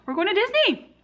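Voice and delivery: high thrilled squeal; excited. Real, not acted. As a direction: squeal it with delight. Reveals Disney trip.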